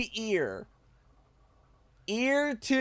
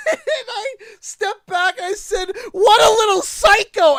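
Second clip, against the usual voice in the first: high, loud laughing shout; 10 dB louder than usual. Real, not acted. Mocking the kid while laughing. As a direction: laugh through the words.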